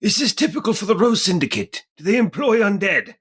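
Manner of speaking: high-pitched in-character voice, put on throughout; high character voice addressing a companion; [name]'s character voice. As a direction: pitch it way up for an acted game character. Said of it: "and say".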